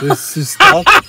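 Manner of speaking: high, slow, loud stretched word; drawn-out high voice. Acted. Friend drawls word mockingly.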